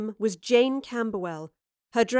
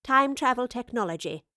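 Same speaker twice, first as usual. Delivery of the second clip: plain reading voice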